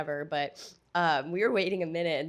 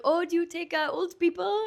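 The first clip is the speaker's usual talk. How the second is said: high-pitched mock French accent; German impression slipping into French, pitched way up; accidental French accent; 12 semitones higher, 3 dB louder than usual. Imitating German tourists asking the bouncer, slipping into French accent. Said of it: "see, there's the French accent".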